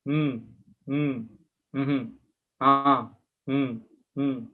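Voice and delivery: short, subdued grunts, spaced apart; imitated meek husband. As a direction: hum along like a scolded husband. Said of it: "He was just saying like that".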